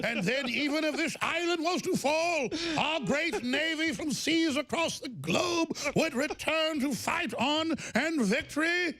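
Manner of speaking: orate like Churchill rallying the nation; Churchillian British accent. Pretend. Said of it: "Churchillian accent".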